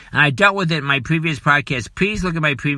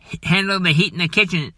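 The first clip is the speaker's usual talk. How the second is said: usual speaking voice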